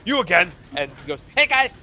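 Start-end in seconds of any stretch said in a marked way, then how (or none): 0.1-0.5 raised, higher exclamation of recognition; acted shout from an exasperated guard; guard calling out. Guards shout at passing Brisk. 1.4-1.8 loud, high hail in passing; raised-voice greeting tossed to the guards; cheery called greeting. Acted. Brisk greets guards running past.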